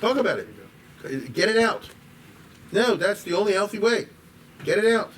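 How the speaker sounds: fervent high-pitched plea; urgent insistence. Retold or imitated. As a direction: urge them to let it all out. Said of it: "he's the exact opposite".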